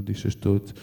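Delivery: usual speaking voice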